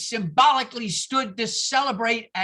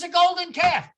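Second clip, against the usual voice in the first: higher, louder impatient answer; impatient shout; 7 semitones higher, 5 dB louder than usual. Retold or imitated. Maureen shouting the answer to Sorkin, impatient. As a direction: shout it back, fed up. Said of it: "She says".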